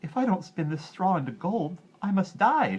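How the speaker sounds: high, loud sob; a girl weeping at the wheel; sobbing girl. A narrator's reading. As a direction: sob it out in despair. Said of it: "she sobbed".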